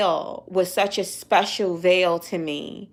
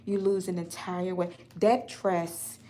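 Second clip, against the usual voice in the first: much softer, close to a murmur; despairing, quiet; 4 semitones lower, 13 dB quieter than usual. Real, not acted. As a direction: speak low and deflated, almost to yourself. Despairing over the missing dress.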